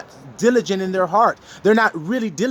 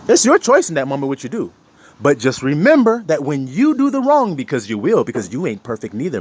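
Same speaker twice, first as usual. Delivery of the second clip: usual speaking voice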